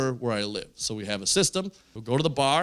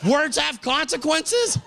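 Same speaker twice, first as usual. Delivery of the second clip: incredulous; 13 semitones higher, much slower than usual. Real, not acted. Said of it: "That's my catchphrase?".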